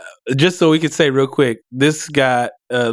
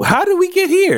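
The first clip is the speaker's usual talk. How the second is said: high-pitched disbelief, much higher; incredulous squeak. Real, not acted. Friend reacting to a baffling political tangent, high incredulous squeak.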